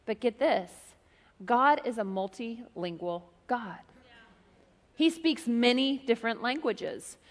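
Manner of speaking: normal talking voice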